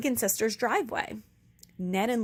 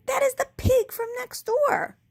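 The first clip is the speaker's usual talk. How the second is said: scornful; 6 dB louder than usual. A narrator's reading. Eugenia scornful correction.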